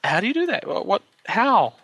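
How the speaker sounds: high, drawn-out incredulous questions; baffled disbelief. Retold or imitated. Cohost imagining the sock stunt, baffled and slow. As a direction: stretch the bewildered whats and hows out slowly. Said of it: "the first thing that came to mind was".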